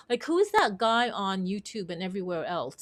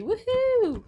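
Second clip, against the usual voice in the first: much higher, gleeful woohoo; excited cheer; 13 semitones higher, 4 dB louder than usual. Real, not acted. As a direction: cheer it like a party starting. Cheering about her balloons.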